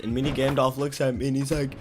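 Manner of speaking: ordinary talk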